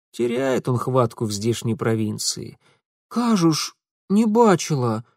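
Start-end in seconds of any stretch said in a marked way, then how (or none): 3.1-5.1 put-on higher, wary girl voice. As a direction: back away while denying it. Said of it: "глядела с опаской".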